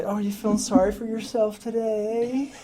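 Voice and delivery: teasing sponsor, lilting upward; mock sympathy. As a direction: needle them with fake pity. Sponsor needling him, acted.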